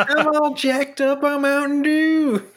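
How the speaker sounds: high, slow jacked-up voice; sing-song meme quote; hyped meme voice. Pretend. Quoting a meme line.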